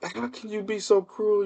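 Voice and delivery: high, hushed mock dismay; shocked girlfriend. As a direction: imitate the girlfriend's appalled reproach. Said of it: "She was like".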